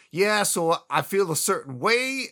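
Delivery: mock sheepish mobster. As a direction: stretch it out uneasily. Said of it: "he's just like".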